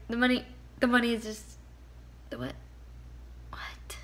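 mock tongue-tied repeats, trailing off; teasing impression of a confused stammer; imitating stuttering. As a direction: imitate someone losing the thread. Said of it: "he'll start stuttering and he's like".

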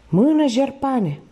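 high, drawn-out command; goading cry to keep swimming; urging on. A narrator's reading. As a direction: urge him on, stretching the words high. Thief urges exhausted swimmer on.

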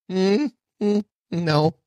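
skeptical no. Real, not acted. Rejects the quoted prediction.

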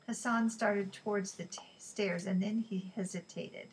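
ordinary delivery, nothing marked

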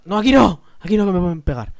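loud, high burst of alarm; alarmed shout. Real, not acted. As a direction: yelp a warning to yourself. Sudden danger in game.

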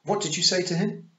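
high, loud suspicious snap; snapped. A narrator's reading. The Queen snaps at Tiffany, high and loud. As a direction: bite the question off sharply. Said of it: "snapped the Queen".

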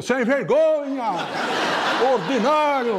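high, drawn-out hollered abuse; imitated shouted insults. Country man joining in, thinking it's insults, mock hollering. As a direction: mimic a yokel heckling at full stretch. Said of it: "o caipira levantou e falou".

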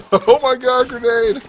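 high panicked warning shout; alarmed shout. Real, not acted. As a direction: yell a sudden warning. Grenade lands nearby.

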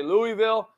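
Louisville in three syllables, LOO-ee-vill. Shown as a demonstration. Host listing how viewers say the city, Louisville as LOO-ee-vill. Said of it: "some of you say".

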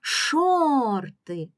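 "шорти" called out, SHOR long, high and loud; loud drawn-out call stressing SHOR in "шорти"; calling the word. Shown as a demonstration. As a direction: stretch and lift the first syllable SHOR of "шорти". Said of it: "Гукаємо слово".

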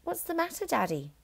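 lightly voiced little girl; sweetly innocent child; Matilda's voice. A narrator's reading. Matilda teasing her father, innocent. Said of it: "she said".

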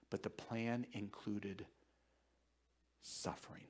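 solemn hush. Real, not acted. Solemn point about suffering.

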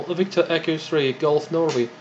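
usual speaking voice

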